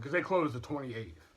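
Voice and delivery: usual speaking voice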